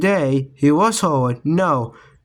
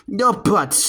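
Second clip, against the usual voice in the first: shouted; 5 semitones higher than usual. A narrator's reading. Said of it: "shouted Mom".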